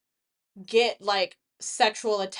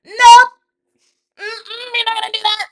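much higher and louder, blocking; firm shout; 11 semitones higher, 15 dB louder than usual. Retold or imitated. Protective friend loudly stopping a guy flirting. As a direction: shut it down from across the room.

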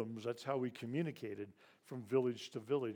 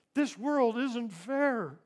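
high, loud and slow, as if the words are pulled out in pain; anguished realization; 10 semitones higher, 7 dB louder, much slower than usual. Retold or imitated. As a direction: let it break out of the child all at once. Child's painful realization.

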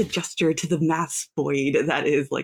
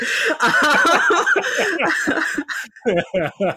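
long, high-pitched burst of laughter; laughing; 11 semitones higher, 5 dB louder than usual. Real, not acted. Player amused while looking to Cricket, real laughter. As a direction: crack up loudly, then let it tail off.

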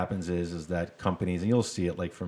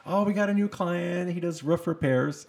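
put-on high, drawn-out Chicago accent; 10 semitones higher than usual. Quoting a Chicago friend about a new client, Chicago accent. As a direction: put on a high Chicago voice, dragging "roof repairs". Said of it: "a friend of mine from Chicago say that".

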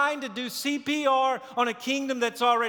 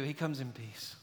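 dropped to a hush, much softer and lower; hushed answer; 9 semitones lower, 12 dB quieter than usual. Shown as a demonstration. Preacher answering his own question in a hush. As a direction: let the answer fall soft and low. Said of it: "But what does he do?".

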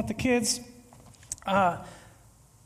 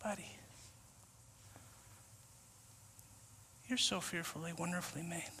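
much softer, low and gentle; tender reassurance; 6 semitones lower, 18 dB quieter than usual. Retold or imitated. Jesus calming the anxious believer, soft and tender. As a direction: speak it like a loving friend.

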